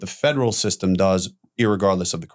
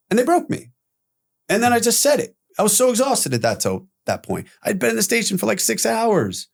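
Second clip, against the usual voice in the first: strained high voice; 10 semitones higher than usual. Real, not acted. Emotional recounting of breaking.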